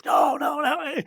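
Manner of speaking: high-pitched, agitated protest, imitated; retold rant. Interviewee ranting back at the journalist, retold as high protest. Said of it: "went off on this complete rant".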